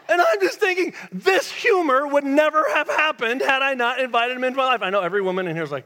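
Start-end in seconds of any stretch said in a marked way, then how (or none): 0.1-4.8 high, loud, impassioned shout; emphatic preaching. Real, not acted. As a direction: drive the point home at full voice. Preacher's heated point about friendship.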